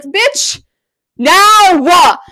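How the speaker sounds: high, loud mock-impatient demand. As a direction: demand it at once, pitch shooting up. Acted impatient demand in text retelling.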